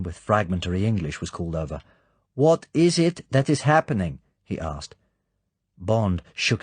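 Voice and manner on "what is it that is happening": high, loud voice of a foreign soldier; a raised, halting foreign questioner. A narrator's reading. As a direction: ask it as a puzzled foreigner. Foreign soldier asks Bond.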